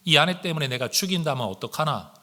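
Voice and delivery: ordinary talk, nothing marked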